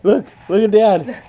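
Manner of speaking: high playful coaxing. Real, not acted. Dad coaxes baby son to look at him, playful high voice.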